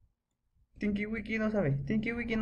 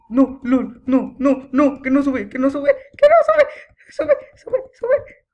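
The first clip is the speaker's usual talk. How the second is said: loud cries climbing to a high squeal; panicked screaming; 5 semitones higher, 11 dB louder than usual. Real, not acted. Player whose character won't climb, panicked high screaming. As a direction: build from shouting into a frantic, high shriek.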